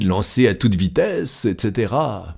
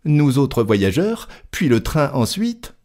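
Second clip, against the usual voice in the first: higher-pitched, stubborn objection; grumbling Passepartout; 4 semitones higher than usual. Acted. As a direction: start high, then reason on insistently.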